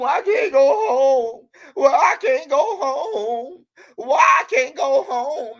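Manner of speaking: theatrical fake sobs, pleading and drawn out; mock crying. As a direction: whine it out like a grown man bawling at the station, overdone on purpose. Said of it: "in a police station crying like a little mama".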